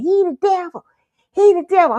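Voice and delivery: put-on high, loud little-girl accusation; insistent child. Little girl accusing a man as grandpa apologizes, insistent.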